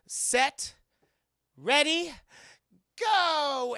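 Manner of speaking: high, loud countdown with big gaps; race-starter call. Retold or imitated. Woman starting a street race, loud hyped call. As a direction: holler it out, stretching the last word.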